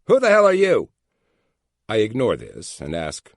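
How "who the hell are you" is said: much higher, louder furious snap; irritated snap. A narrator's reading. Angry agent on the phone snapping at the caller, furious. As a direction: spit it back in a rage. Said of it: "A very irritated voice spits back".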